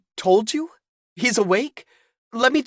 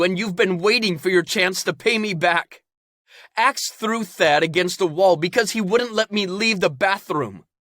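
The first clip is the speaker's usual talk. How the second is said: high, loud angry rant; furious yelling; 12 semitones higher, 8 dB louder than usual. Acted. Caden yelling at his parents so outsiders hear. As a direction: shout him down, furious. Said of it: "Caden screamed".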